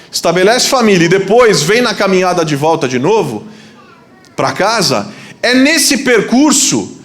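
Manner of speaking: high, projected sermon delivery; rousing preacher with soaring emphatic phrases; impassioned preaching. Real, not acted. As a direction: drive the point home to the congregation. Preaches at full force.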